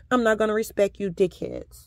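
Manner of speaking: normal talking voice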